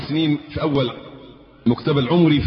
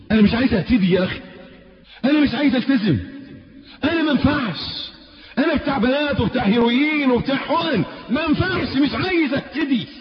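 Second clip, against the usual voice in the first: high, desperate shouted confession; a retold sobbing shout, much higher; anguished cry; 10 semitones higher than usual. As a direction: cry it out like a broken young man who refuses guidance. Young man's tearful outburst retold.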